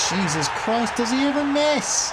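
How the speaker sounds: high, incredulous cry; can't believe he scored again; exasperated disbelief. Real, not acted. Fan groaning at the striker's goal, high incredulous cry.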